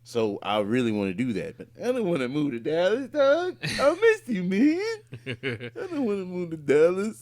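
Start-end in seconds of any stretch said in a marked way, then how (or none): 1.8-4.8 high, loud whiny pleading, hammed up; mock whine. Whining to his friend about moving to Dallas, hammed-up high whine. 5.3-7.2 mock whine. Whining to his friend about moving to Dallas, hammed-up high whine.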